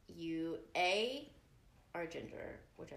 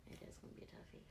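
whispering; 15 dB quieter, much faster than usual. Real, not acted. Echoing whisper back.

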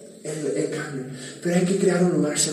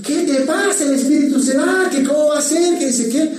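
high, startled, half-laughing retort; mock shocked outburst; 8 semitones higher than usual. Pastor retelling his shocked reaction to a church member, high and startled. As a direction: play your own comic alarm at the song.

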